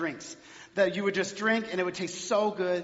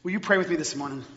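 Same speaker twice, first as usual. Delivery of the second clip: usual speaking voice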